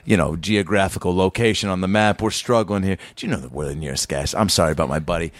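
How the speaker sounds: calm it down, low and sorry; peacekeeper. Retold or imitated. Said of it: "I'm trying to be the peacekeeper".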